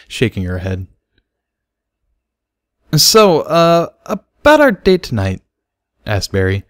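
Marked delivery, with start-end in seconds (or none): 3.0-5.4 higher, louder male character voice, a little hesitant; Barry's voice. A narrator's reading. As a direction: ask about the date, a bit awkward.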